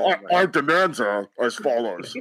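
menacing disguised ransom voice; anonymous hacker voice. Pretend. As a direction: read the demands like a masked villain. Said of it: "Go full anonymous here".

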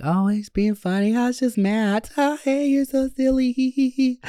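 high, giggly fake laugh. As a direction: squeal it out like a naive girl laughing it off. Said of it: "I was like".

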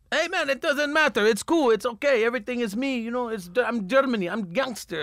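high, breezy broken-English German; mock German gangster. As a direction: shrug off the stolen car. German guy reassures about stolen car.

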